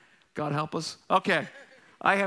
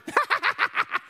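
Joker cackle; 12 semitones higher than usual. Retold or imitated. Impersonating the Joker.

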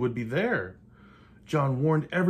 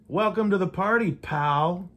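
loud, higher, fast triumphant yell; delighted yell; 6 semitones higher, 7 dB louder than usual. Acted. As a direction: shout it out gleefully at the fleeing cop. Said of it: "John yelled with delight".

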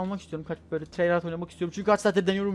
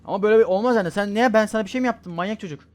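higher, louder indignant yelling; angry shout; 5 semitones higher, 11 dB louder than usual. Real, not acted. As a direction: scold the attacker. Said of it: "çok sinirlendim".

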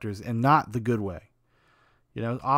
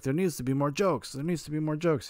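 higher-pitched hammed-up Italian accent; 6 semitones higher than usual. Film producer constantly interfering to demand jokes, mock Italian accent. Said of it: "That's my great Italian accent".

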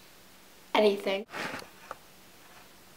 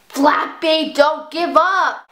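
loud, raised, drawn-out urging; urgent plea; 5 semitones higher, 5 dB louder, much slower than usual. Real, not acted. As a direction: beg the bird loudly to continue. Pleading with the game bird.

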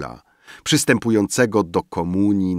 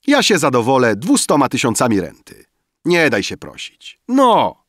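raised, ceremonial urging; solemn; 6 semitones higher, 5 dB louder than usual. A narrator's reading. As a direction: press your friend grandly, ending on a loud push. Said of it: "rzekł Emil uroczyście". (uroczysta, głośna namowa)

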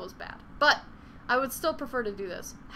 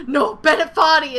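much louder, higher mock cry of despair; mock anguished cry; 5 semitones higher, 15 dB louder than usual. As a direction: wail his name like a haunted veteran. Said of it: "I'm just like".

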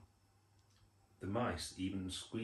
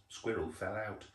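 usual speaking voice

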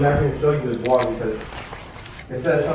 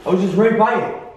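panicked; 8 semitones higher, slower than usual. Real, not acted. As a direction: gasp it out in real terror. Panic after seeing something move.

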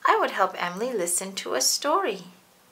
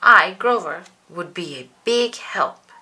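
louder proud Grover; proudly; 3 semitones lower, 8 dB louder than usual. Acted. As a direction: puff up with pride. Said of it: "he said proudly".

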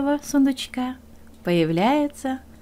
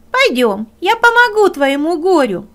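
higher, louder rooster voice; rooster asking; 7 semitones higher, 8 dB louder than usual. Acted. Rooster asks the hare why he cries, higher and louder. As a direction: ask brightly like a rooster.

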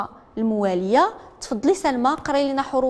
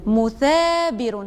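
slow model reading; much slower than usual. Shown as a demonstration. Teacher echoes the pupil's مثابر as a model, slow and drawn out. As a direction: draw مثابر out, holding the long ثا.

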